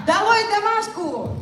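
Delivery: high rallying shout; mock protest cry. Host leads the audience against homework, rousing shout.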